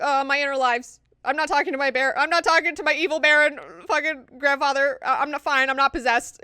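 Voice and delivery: loud, high, hammed-up frantic excuse; mock panicked denial. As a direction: blurt it out as a badly hidden cover-up. Acting Alia's panicked cover story.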